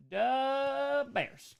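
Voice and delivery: high, long-held "The" in mock Chicago accent; hammed-up Chicago fan chant; Chicago fan catchphrase.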